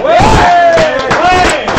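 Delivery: loud, high whoop of joy; cheering shout. Real, not acted. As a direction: cheer like the crowd. Cheering with crowd. (cri de joie)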